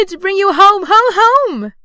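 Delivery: put-on very high, loud, jubilant child; overjoyed little girl. A narrator's reading. Girl bringing her brother home, jubilant. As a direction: squeal the good news in triumph. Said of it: "bending down to laugh".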